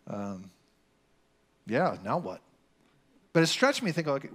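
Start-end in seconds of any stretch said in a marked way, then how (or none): 0.0-2.4 lower, hushed, simple words to God; a boy praying, unsure what comes next; lightly voiced young believer praying, lower; naive prayer voice. Retold naive early prayer.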